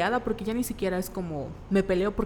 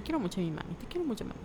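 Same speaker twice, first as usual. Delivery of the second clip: tender; 8 dB quieter, faster than usual. Real, not acted. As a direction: coo it lovingly, very softly. Tender aside to mom.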